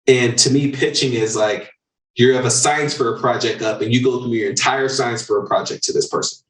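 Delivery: ordinary talk, nothing marked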